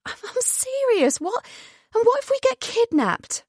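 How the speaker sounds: very high-pitched, drawn-out worry; alarmed protest. Acted. Cora insists to joking Jonathan they could be kidnapped, alarmed. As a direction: voice shoots up in alarm.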